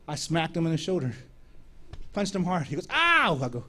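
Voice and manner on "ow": high imitated "ow"; mock yelp of pain. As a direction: yelp as if just punched. Pastor punched in the shoulder.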